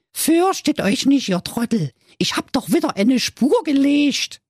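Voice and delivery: put-on high, cocky little boy. Little Däumling speaks to siblings.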